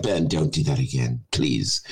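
imitated low, weary plea; pleading listener. Host imitating co-host fed up with his accent, low mock plea. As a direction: beg him to stop, pitched low. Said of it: "And you're like".

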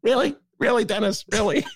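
high, mocking "Really?" copy; teasing imitation of the Shapiro bit; mock Shapiro echo. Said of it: "this great imitation".

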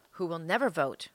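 ordinary talk, nothing marked

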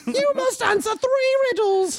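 very high, slow put-on voice; mock riddle keeper. Friend mocking British fantasy voices, very high and slow. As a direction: intone it like a silly fairy-tale guardian.

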